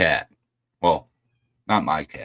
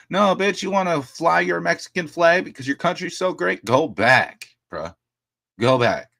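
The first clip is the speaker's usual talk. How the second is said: high, loud furious outburst at protesters; angry yelling rant; 12 semitones higher, 5 dB louder than usual. Real, not acted. Streamer ranting at flag burners, furious high yell. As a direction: let it rip, voice climbing and shouting.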